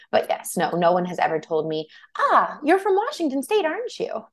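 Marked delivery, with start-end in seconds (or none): 2.2-4.3 very high, put-on voice of discovery; mock delighted recognition. Imagined stranger recognizing a Washington accent, mock knowing surprise. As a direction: imitate a stranger spotting an accent, pitched way up.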